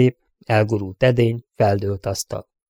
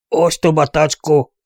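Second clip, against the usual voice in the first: shout; 5 semitones higher, 4 dB louder than usual. A narrator's reading. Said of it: "kiáltotta Wyoming".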